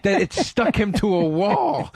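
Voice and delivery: slow, high mock seething; worked-up imitation. College friend confessing hate fantasies, worked-up imitation. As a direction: play the friend savoring his rage, drawn out. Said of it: "he would get so worked up".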